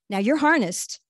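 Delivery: ordinary delivery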